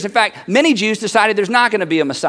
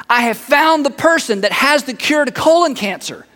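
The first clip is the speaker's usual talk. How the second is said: higher, emphatic good news; excited announcement; 5 semitones higher than usual. Acted. Somebody runs up with news of a cure, excited. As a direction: announce a miracle find with urgency. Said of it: "somebody ran up to you".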